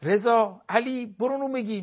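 mother yelling for her son to fetch bread; calling out. Retold or imitated. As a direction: shout the kid's name from another room. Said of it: "هی داد نزن وقتی تو اتاقه".

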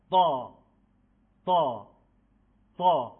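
قا said loud and firm; loud, firm repetition drill; firm emphatic ta. Shown as a demonstration. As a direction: say it with full firmness, each one alone.

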